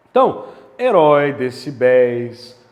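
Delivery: "herói" and "decibéis" loud, stretched on the last syllable; final syllable stressed. Shown as a demonstration. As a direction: punch the open final syllable. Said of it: "teacher stressing final syllables".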